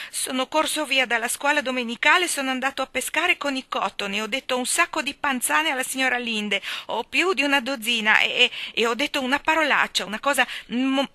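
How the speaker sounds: put-on loud, high child's confession rushed out; all in one breath. A narrator's reading. Davy confessing his sins to Anna, all in one breath. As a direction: blurt it out like a boy, stumbling on. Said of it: "Lo disse d'un fiato".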